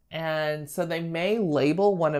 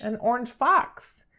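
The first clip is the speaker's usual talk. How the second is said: put-on bright, higher praise for a child's answer; modelled praise; 8 semitones higher than usual. Shown as a demonstration. Teacher praising a child's guess, encouraging. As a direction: reward the child's answer eagerly. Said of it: "I'll be like".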